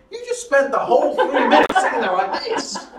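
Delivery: high, loud, laughing mock outrage; exasperated disbelief. Speaker thinking at the keen listener, laughing disbelief. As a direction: blurt out your inner disbelief, laughing. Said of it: "you're sitting there thinking".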